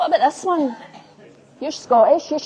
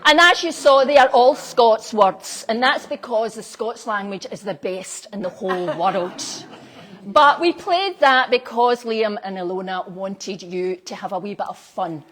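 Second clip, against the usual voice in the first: ordinary talk, nothing marked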